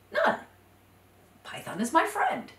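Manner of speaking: squeaked high, quick protest; surprised denial. Acted. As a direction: insist on innocence. Iguana denies, high squeak.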